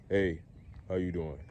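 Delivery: low, soft, understated hello; walk up and greet her casually; casual, normal greeting. Shown as a demonstration.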